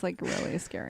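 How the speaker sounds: hushed, half-joking fear; whispering. Real, not acted. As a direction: breathe it out in a whisper. Scared of getting a booth.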